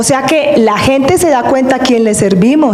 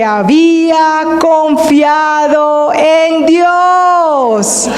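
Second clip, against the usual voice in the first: proclamando; 3 semitones higher, much slower than usual. Acted. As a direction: cry it out like a preacher's climax. El predicador clama la frase. (gritando despacio, más agudo)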